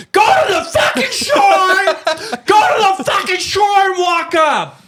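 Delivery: high, slow shout across the river; shouted order. Retold or imitated. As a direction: bellow it so they hear you over the rapids. Ordering family to the shore.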